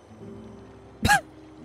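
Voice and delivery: breathy squeal of a laugh; real giggle fit; high squeaky laugh. Real, not acted. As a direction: burst into a stifled squeal. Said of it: "I'm not gonna laugh".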